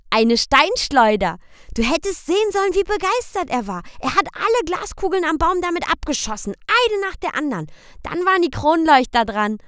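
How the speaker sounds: put-on high, fast excited child; eager children. A narrator's reading. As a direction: tumble out the story like a thrilled kid. Boy Michel tells excitedly.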